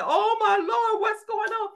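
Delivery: high, stunned exclamation; awestruck. Retold or imitated. Preacher imagining falling down before a talking donkey, high awed gasp. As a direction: gasp it out on your knees. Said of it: "fell down on my knees ... and said".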